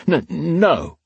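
high, stammered denial; frightened blurt. A narrator's reading. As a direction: blurt it out in fear. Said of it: "Phelan Parr blurted".

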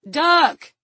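loud, high shout; shouted warning. A narrator's reading. Man warns companion of attack, shouting. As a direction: yell it out as an urgent warning. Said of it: "he shouted".

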